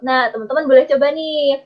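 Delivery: ordinary talk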